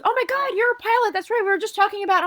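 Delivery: imitating a very high, chattering coworker; high-pitched mock excitement; excited coworker. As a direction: gush it way up high, as a mimicked coworker. Chatty coworker corners her.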